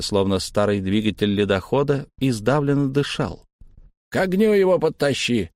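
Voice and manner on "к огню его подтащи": higher gruff bark; barking order. Acted. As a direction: bark the order across the room. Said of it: "гаркнул тавернщик". (гаркнуть приказ)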